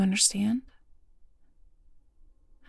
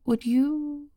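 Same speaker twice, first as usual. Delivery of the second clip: tender whisper; 5 dB louder than usual. Acted.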